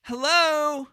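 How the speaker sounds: high-pitched, badly faked questioning hello; mock wife. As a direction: mimic a clueless wife calling out. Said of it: "She's like".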